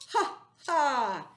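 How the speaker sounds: loud mock laugh; hammed-up happy laugh; happy monster laugh. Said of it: "I'd go ha".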